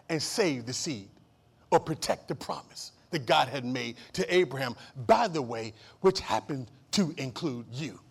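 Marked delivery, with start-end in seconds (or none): none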